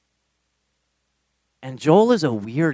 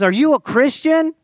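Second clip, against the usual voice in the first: loud, high-pitched, in-your-face question; intense stranger's question; 13 semitones higher, 5 dB louder than usual. Retold or imitated. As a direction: blurt it right into their face, voice pitched way up. Re-voicing the stranger's blunt question.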